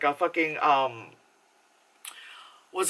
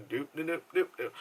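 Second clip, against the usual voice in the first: very soft doop doop under the breath; barely voiced doops, almost silent; muffled nonsense hum; 10 dB quieter, faster than usual. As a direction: hum it like the world is muted. Mimics the deaf sister's silent world.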